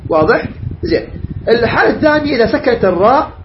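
normal talking voice

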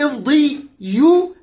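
امضيوا drawn out, loud on the last syllables; emphatic syllables. Shown as a demonstration. Teacher pointing out the vowelled ya, emphatic syllables. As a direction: lean hard on the di and yu of امضيوا.

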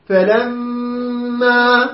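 فلما said very slowly with the meem held long; فلما, meem lingered on nasally; doubled meem with ghunna. Shown as a demonstration. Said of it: "ميم مشددة كالنون مشددة".

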